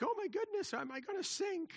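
high, squeaky mock panic; hammed-up alarm of sinking; panicky disciples. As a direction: play a frightened sailor, high and thin. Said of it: "they're like".

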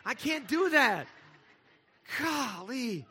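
young brother marvelling at fake tears; impressed kid. Impressed little brother gasping at sister's fake crying. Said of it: "and I'm like".

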